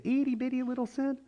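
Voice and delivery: high, small squeak of a voice; tiny cutesy voice. Acted. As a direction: pitch it way up to sound teeny. Mimicking smallness of a sin.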